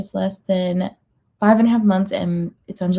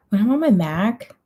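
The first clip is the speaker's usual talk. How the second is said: ordinary talk, nothing marked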